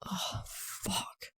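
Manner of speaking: breathless whisper; a shocked aside under the breath; whispered oh fuck. A narrator's reading. Whispered realization.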